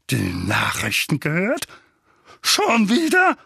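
incredulous echo swooping upward; scandalised, rising high; outraged disbelief. A narrator's reading. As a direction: repeat it as an indignant question. Outraged echo of the boy's answer.

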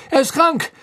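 flustered official, high and loud; high, insistent exclamations; loud agitation. A narrator's reading. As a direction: call out urgently in alarm. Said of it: "verkündete Fudge laut".

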